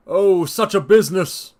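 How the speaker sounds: loud, high horrified cry; panicked doctor crying out; cried out. Acted. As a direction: wail it with hands to his temples. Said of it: "he cried".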